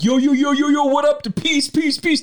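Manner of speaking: high, loud run of hype shouts; mock hype voice. Wife mocking his podcast video voice, high hyped imitation. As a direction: mimic an over-pumped podcast host. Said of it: "How do you go from".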